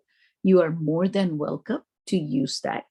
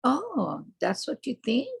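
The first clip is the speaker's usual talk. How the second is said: sarcasm; 6 semitones higher than usual. Shown as a demonstration. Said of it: "These are sarcasm".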